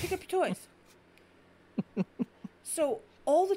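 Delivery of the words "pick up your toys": mock scolding cat. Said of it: "And he just looks at me".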